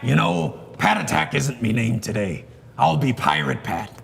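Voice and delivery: low growly pirate. Acted. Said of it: "you sound like a pirate".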